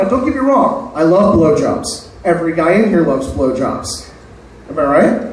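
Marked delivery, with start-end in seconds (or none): none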